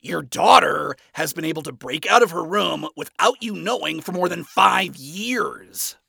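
ordinary delivery

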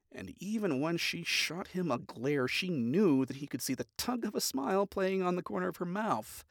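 plain reading voice

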